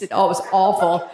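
ordinary delivery

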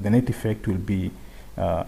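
normal talking voice

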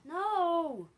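high, very loud disbelief into a long "No!"; exasperated yell. Real, not acted. Player getting a little mad at the game, exasperated yell. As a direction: yell in outraged protest, pitch way up. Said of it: "I'm getting a little mad".

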